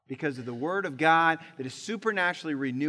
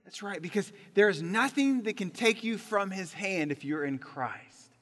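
ordinary talk

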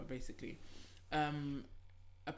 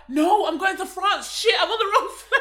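mock panic; 9 semitones higher, 5 dB louder, slower than usual. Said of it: "Imagine you were like".

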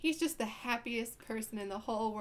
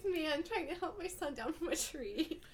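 unbothered mom; 6 semitones higher than usual. Retold or imitated. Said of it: "the lady doesn't care".

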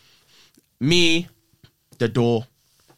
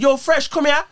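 high-pitched call to a mate; 14 semitones higher, 4 dB louder, slower than usual. Retold or imitated. Calling Fresh over in front of police, retold calling out.

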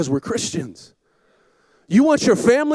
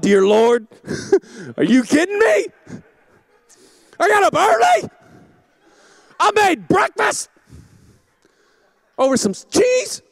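mock despair, pitched up high and strained; exasperated outburst; 7 semitones higher than usual. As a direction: wail at the ruined breakfast, laughing at yourself. Retold exasperated outburst at cheese.

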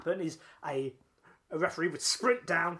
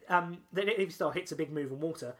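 ordinary talk

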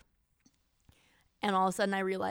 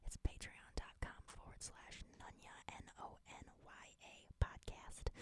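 hushed ASMR-style whisper; soft, secretive podcast whisper; 17 dB quieter than usual. Pretend. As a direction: whisper the web address into the mic. Whispers Patreon address as ASMR joke.

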